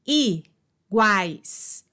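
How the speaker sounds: "iguais" split as i-guais; divisão silábica. Shown as a demonstration. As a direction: say "iguais" in two parts with a pause after i. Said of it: "na mesma sílaba". ("iguais" pausado, em i-guais)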